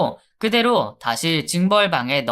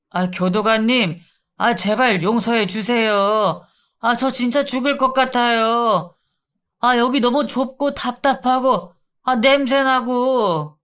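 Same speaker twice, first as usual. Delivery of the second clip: whiny begging; 6 semitones higher than usual. Acted. Inmate pleading with the guard, higher whining voice. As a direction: moan and beg like you're suffocating.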